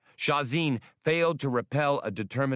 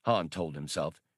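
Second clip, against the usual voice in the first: ordinary delivery, nothing marked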